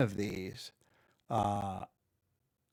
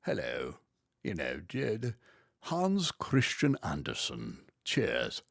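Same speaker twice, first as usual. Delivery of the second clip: put-on old-fashioned British; 4 semitones lower, slower than usual. Shown as a demonstration. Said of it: "maybe old-fashioned".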